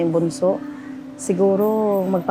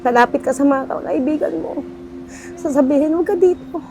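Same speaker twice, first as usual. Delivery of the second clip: tearful, high and choked up; crying; 8 semitones higher than usual. Real, not acted. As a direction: voice breaking into tears, real. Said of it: "Naiyak ako kasi".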